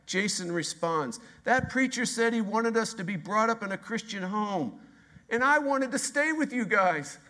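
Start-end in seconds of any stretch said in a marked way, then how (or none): 1.4-7.2 put-on higher, pleading little boy; child voice. Jason answering his dad, high pleading child voice. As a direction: play the upset kid explaining himself.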